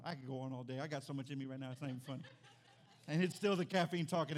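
normal talking voice

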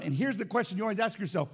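ordinary delivery

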